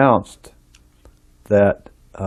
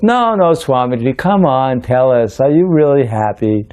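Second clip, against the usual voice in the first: higher-pitched coaxing, imitating an eager boy; mock-pleading young questioner; insistent pleading; 5 semitones higher than usual. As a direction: press him for an answer. Boy presses swami in retold story.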